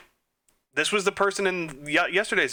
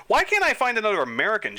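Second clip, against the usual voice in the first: high, loud, fast frustrated complaint; fed up with the search, blurt it out; rushed, exasperated whine; exasperated outburst; 8 semitones higher, 4 dB louder, faster than usual. Real, not acted. Frustrated search under time.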